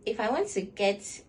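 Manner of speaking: normal talking voice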